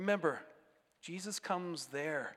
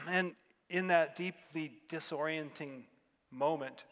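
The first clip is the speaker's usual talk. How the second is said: ordinary talk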